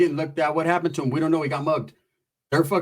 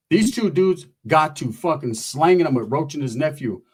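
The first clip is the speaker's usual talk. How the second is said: ordinary talk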